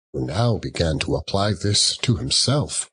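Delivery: plain reading voice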